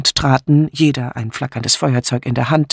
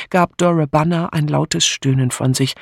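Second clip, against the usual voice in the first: usual speaking voice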